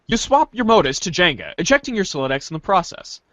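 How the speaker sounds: normal talking voice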